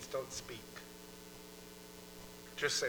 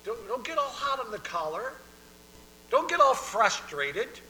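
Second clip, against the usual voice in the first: God admonishing; 8 semitones higher, 8 dB louder than usual. Acted. Voices God checking frustration.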